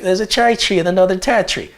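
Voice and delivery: quick, high mock Australian; imitated Australian accent. Visiting girl repeats the test phrase, imitated Australian accent. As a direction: rattle it off in an exaggerated Australian accent. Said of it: "And she's like".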